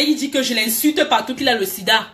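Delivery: ordinary talk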